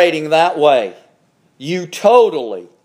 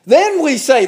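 usual speaking voice

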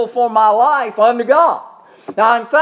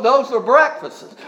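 usual speaking voice

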